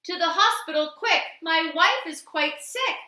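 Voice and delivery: a frantic husband hurrying the cab; urgent cry. Acted. Husband in a panic begging the taxi to rush to hospital. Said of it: "cried a man".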